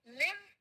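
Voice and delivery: लिव with a brief i; short-i live. Shown as a demonstration. Teacher drilling the class, लिव with short i. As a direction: model लिव on a short vowel. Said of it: "बड़ी ई छोटी ई होता है".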